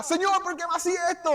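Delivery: queja aguda y lastimera; ladrón quejándose. Retold or imitated. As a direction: imitando un lamento exagerado. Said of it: "él se quejaba". (imitando al ladrón que se queja, agudo)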